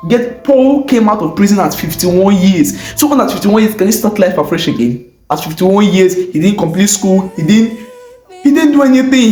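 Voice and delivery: plain reading voice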